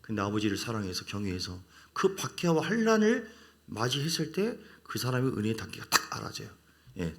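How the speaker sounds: ordinary talk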